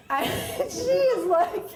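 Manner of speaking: laughing. Real, not acted. Laughing retelling neighbor reaction.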